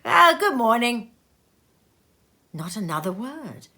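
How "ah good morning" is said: loud, brisk goodbye; hint of a busy merchant; curt farewell. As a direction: cut the talk off with it. Businessman parting.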